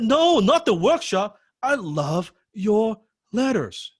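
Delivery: excited fan. Retold or imitated. Fan insisting he loves the writer's letters, high eager voice. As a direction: plead it with giddy devotion. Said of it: "I said".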